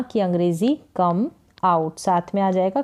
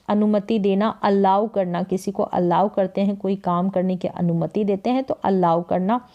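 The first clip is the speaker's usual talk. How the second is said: normal talking voice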